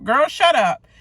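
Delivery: high, loud scoff; exasperated shout. Real, not acted. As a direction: yell at the screen in disbelief.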